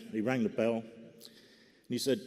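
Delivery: ordinary talk, nothing marked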